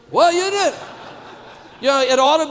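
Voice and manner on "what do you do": higher and louder each time, punching the question; rising emphatic question. Retold or imitated. Preacher to his congregation, louder asking what they do. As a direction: press the question with growing energy.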